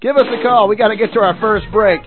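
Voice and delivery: ordinary talk, nothing marked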